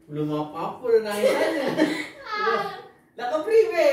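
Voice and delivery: high, loud, laughing disbelief; laughing tease. Real, not acted. Laughing at crying child. (menggoda sambil tertawa keras)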